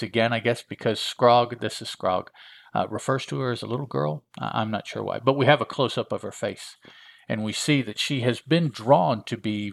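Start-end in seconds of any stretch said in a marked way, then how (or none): none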